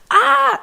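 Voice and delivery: high squeal of delight; excited shriek. Retold or imitated. Girl reading flyer shrieks with excitement. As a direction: shriek with joy. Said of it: "when she read it".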